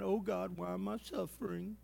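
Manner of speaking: soft, whiny mock lament; a half-hearted self-pitying whine to God; mock whining. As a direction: pretend to feel sorry for yourself. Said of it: "we'd be whining and crying".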